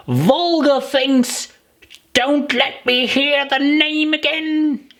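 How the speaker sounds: a tiny creature shaking with outrage; trembling angry mouse. Acted. The offended mouse scolding Alice about cats, squeaky outrage. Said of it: "trembling down to the end of his tail".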